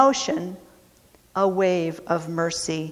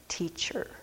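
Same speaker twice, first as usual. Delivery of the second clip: breathy whisper of the word; 2 semitones lower, 15 dB quieter than usual. Acted. Blind man addressing Jesus, hushed whisper. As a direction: breathe it out reverently.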